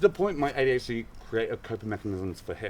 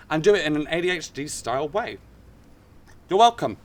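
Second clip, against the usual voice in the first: mock proud; 6 semitones higher, 7 dB louder than usual. Self-mocking proud segue.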